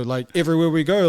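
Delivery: ordinary talk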